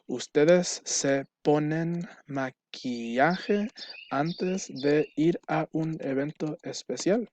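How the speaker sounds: slow, rising question read aloud; question intonation. Shown as a demonstration. Teacher modeling a Spanish question to students, slow and rising. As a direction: stretch it out, lifting into a question. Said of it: "Just put the question mark".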